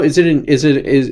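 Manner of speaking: usual speaking voice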